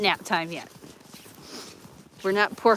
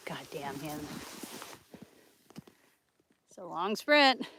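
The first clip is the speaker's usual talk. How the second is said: ordinary delivery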